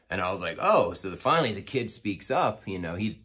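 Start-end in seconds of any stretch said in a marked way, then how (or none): 0.6-0.9 surprised oh. Retold or imitated. Taunted back by the kid.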